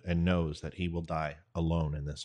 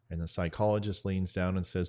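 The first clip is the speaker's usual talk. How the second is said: ordinary delivery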